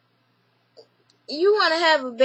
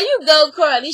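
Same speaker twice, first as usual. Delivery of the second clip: laughing cheer; 5 dB louder than usual. Real, not acted. Reviewer cheering Carly on, laughing loudly. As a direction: cheer her on while still laughing.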